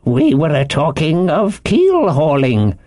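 reluctant drawn-out admission; uneasy seaman owning up; sheepish sailor. A narrator's reading. Morgan confesses to Silver.

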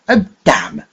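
high, loud irritated oath; annoyed curse. A narrator's reading. Young man thwarted from leaving, curses in irritation. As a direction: spit out the curse in frustration. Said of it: "said the young man".

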